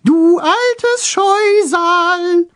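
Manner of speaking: high slow falsetto taunt; falsetto shout. A narrator's reading. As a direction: drag it out in falsetto. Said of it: "rief er mit hoher Fistelstimme".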